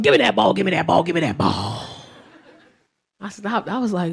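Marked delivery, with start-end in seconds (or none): 0.0-1.9 lightly voiced demanding toddler, dropping low; a hint of low-voiced baby demand; imitated toddler. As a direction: chant it like an insistent little kid. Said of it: "this little red little baby come up to me talking about something".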